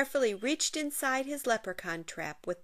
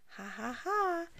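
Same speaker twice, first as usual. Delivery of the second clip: tiny giggle; 8 semitones higher than usual. Acted. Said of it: "a tiny little".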